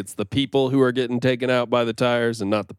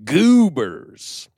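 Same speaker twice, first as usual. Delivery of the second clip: announcer voice; 9 semitones higher, slower than usual. Acted. Show title read dramatically.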